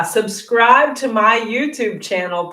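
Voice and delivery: ordinary talk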